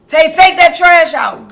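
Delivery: loud, slow, high order; mock harsh command to her son; blunt command. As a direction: bark the order at him. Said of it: "It can't be like you telling him".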